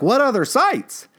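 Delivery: pitched well up, calling down the hallway after him; calling after someone. Retold or imitated. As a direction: call it out after someone walking away. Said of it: "calling out after him".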